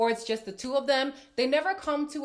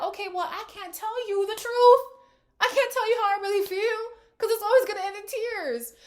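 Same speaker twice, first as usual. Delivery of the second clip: fed-up voice, much higher and louder; exasperated quote; 11 semitones higher, 6 dB louder than usual. Retold or imitated. Imitating a partner tired of the tears, exasperated. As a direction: give up on honesty out loud. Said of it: "the person's gonna be like".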